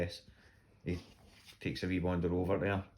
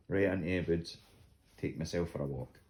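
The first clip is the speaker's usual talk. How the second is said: ordinary talk, nothing marked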